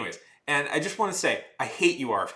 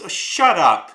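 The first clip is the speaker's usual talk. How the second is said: angry retort; 6 semitones higher, 9 dB louder, slower than usual. Real, not acted. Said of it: "this gets me mad".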